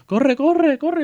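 high, loud, hurried plea; urgent child. Retold or imitated. Child begging dad to rush for a pee, urgent. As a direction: imitate a child desperate to go. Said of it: "el pobre no aguantaba".

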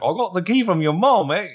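high, loud put-on Australian accent; mock Australian accent. Co-host mimics Jordan to Hannah, hammed-up Australian accent. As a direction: imitate a broad, garbled Australian. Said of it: "No, keep doing it".

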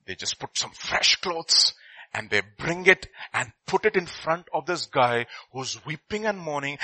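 ordinary delivery

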